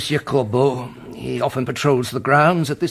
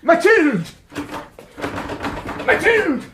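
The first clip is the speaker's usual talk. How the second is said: frantic shouting; 14 semitones higher, slower than usual. Acted. Father yells at the bolted door in panic. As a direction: yell through the locked door.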